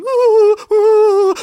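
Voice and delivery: loud, high mournful coo; upset dove cooing. Acted. Storyteller voicing upset morning doves, loud cooing. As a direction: wail like distressed doves. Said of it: "the doves were saying".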